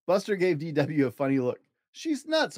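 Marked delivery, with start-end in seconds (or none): 1.9-2.6 much higher, cartoonish boy voice; Buster's voice. Buster to Arthur about sister, high cartoonish voice. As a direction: squeak it like an amazed kid.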